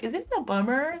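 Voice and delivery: normal talking voice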